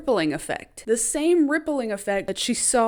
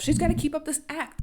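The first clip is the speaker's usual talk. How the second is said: ordinary talk, nothing marked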